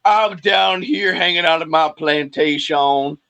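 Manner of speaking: low, slow Southern plantation-owner drawl; Southern accent. Retold or imitated. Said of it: "I like how he's weird, like, Southern".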